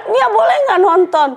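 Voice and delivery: high, slow mock-crying whine; tearful child pleading. Daughter begs to watch, in a high imitated whine. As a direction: mimic a little drama queen begging. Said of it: "Tapi ambil nangis".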